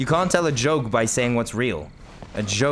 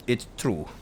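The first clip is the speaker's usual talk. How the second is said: put-on Japanese accent; 5 dB quieter than usual. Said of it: "Japanese accent".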